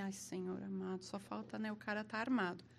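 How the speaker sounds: hushed, scared prayer under the breath; frightened murmur. Retold or imitated. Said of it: "Eu falei".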